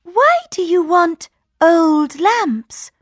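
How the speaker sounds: high, slow puzzled princess; wondering princess; curious princess. A narrator's reading. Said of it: "said the princess".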